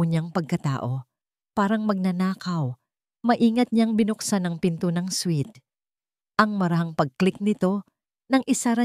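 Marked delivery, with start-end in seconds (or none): none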